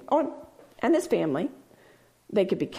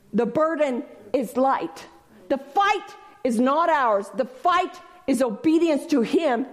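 ordinary talk

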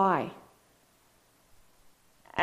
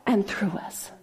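soft unvoiced whisper; awed whispered close; 2 semitones lower, 4 dB quieter than usual. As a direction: let it fall to a whisper. Sermon hush.

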